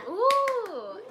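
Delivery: loud, high laughing squeal; delighted laugh. Real, not acted. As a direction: burst out laughing with glee. Machine finally goes backwards.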